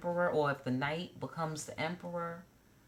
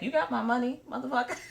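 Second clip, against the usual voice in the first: higher, louder, demanding voice; blunt businesslike hoe; 8 semitones higher, 5 dB louder, faster than usual. Retold or imitated. Imitating a professional collecting her money, higher and demanding. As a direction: call out for the cash like a pro. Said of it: "The hoe was like".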